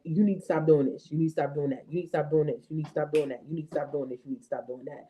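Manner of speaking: rapid-fire nagging that fades down low; nagging mom. Retold or imitated. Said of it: "I have been on her this week".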